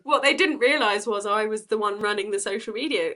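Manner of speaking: laughing through the words; giggling while telling the punchline. Real, not acted.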